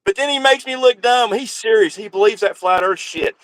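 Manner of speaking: usual speaking voice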